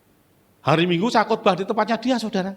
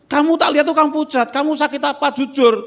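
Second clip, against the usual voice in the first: pressing pastor friend; 10 semitones higher, 5 dB louder than usual. Retold or imitated.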